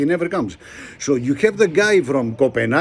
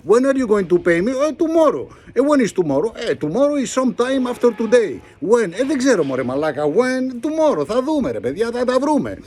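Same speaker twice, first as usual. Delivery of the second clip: mock bankers and Greeks; 6 semitones higher than usual. Banks press Greeks for payment.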